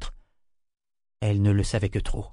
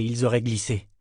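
plain reading voice

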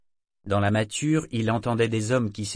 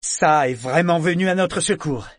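delighted shout; 7 semitones higher, 8 dB louder than usual. Acted. Sailor still delighted, shouting.

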